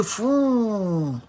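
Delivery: long drawn-out "fooon" gliding down in pitch; imitated window whoosh. Driver lowering both windows for police, imitated whoosh. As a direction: mimic windows sliding down in one breath.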